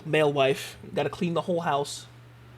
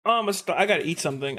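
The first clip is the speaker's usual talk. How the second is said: ordinary delivery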